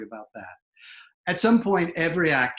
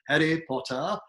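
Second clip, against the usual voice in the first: Harry Potter in a British accent, r's dropped; 3 semitones higher than usual. Shown as a demonstration. Coach imitating a kid's movie-learned British accent. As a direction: say it like a British film character. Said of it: "a little bit of a British accent".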